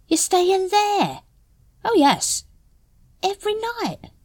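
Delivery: high, slow, disbelieving questions; shocked. A narrator's reading. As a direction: voice climbing in disbelief. Nobby shocked by Carrot's lodging.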